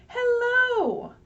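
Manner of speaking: high, loud sing-song hello; tree voice. Acted. The trees greet the reader back in a high, loud voice. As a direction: answer as a cheerful tree.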